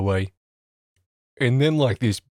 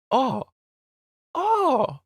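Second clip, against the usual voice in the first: dawning realization. Retold or imitated. Man realizes the misunderstanding.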